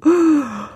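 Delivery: high drawn-out yawn sound; a big waking yawn. Acted. Little fox waking under the oak, yawning.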